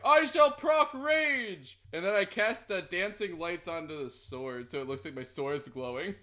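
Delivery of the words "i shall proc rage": pitched-up, drawn-out heroic declaration; barbarian battle cry. Acted. Player as barbarian answering the kids, grand battle cry. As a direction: proclaim it with mock-epic grandeur.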